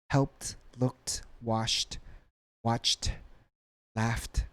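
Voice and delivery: "helped, looked, washed, watched, laughed" as separate words ending in t; final T sound. Shown as a demonstration. Teacher drilling a word list, final T endings.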